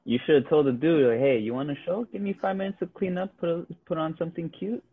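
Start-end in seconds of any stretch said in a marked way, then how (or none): 1.2-4.8 flirty. Retold or imitated. Mock flirty offer to peeper.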